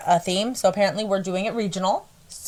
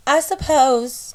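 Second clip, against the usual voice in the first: put-on drawn-out country accent, higher; accidental country accent; 5 semitones higher, slower than usual. Reader attempting the reverend's voice, slipping into country accent. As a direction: aim for English, land in slow country accent. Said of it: "nope, that's the country".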